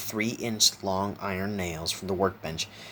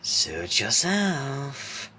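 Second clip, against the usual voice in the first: very slow, higher swooping clown voice; sighing villain; 7 semitones higher, much slower than usual. A narrator's reading. Clown gives up coaxing Isaac, exaggerated sigh. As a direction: sigh it out, stretching each word. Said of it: "Laughing Jack sighed".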